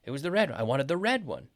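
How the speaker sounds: RED lifted high in contrast; stress on RED. Shown as a demonstration. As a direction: punch RED each time, falling after. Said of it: "it wasn't the blue one".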